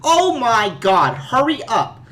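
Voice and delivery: high, slow, loud panicked plea; a mother frantic over her missing son; Joyce impression. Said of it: "At least I'll try".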